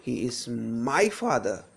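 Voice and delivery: single out "my"; stress on MY. Shown as a demonstration. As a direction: lean hard on "my" before "father". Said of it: "If I stress this word".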